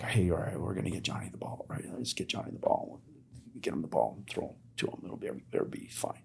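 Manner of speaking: hushed, plotting whisper; huddle whisper. Retold or imitated. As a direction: whisper the plan into the team huddle. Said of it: "they're like".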